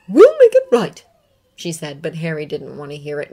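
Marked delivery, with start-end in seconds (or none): 0.1-1.0 put-on higher, louder voice of a stern old witch professor; a firm teacher promising to fix it; McGonagall. McGonagall reassures Harry.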